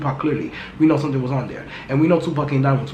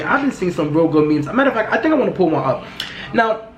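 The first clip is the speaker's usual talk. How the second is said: ordinary talk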